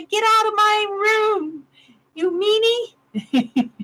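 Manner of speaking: high, slow, mock whiny cry with a laugh; mock crying yell. Old woman shooing the nurse aide, whiny mock cry. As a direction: whine it like a cranky old patient. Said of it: "I'll cry and yell and say".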